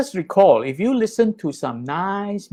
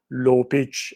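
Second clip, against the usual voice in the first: dropped low demo voice; low pitch; 4 semitones lower than usual. Shown as a demonstration.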